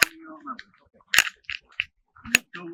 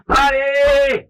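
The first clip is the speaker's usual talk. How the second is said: loud, high call down the stairs; hollering; 8 semitones higher, 7 dB louder than usual. Shown as a demonstration. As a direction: call her name down into the basement. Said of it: "if you have to holler".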